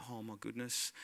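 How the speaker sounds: very low, hushed retold panic; quiet freaking out. Rider reliving the moment the ride starts, hushed dread. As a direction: murmur it under your breath, dreading. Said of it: "I start freaking".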